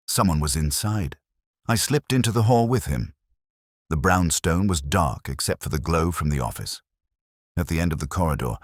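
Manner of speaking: ordinary delivery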